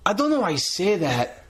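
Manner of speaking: high, affronted showy wrestler; puffed-up mock indignation; Del Rio impression. Host as Del Rio answering a heckler, mock affronted. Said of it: "Do you wanna be A D R".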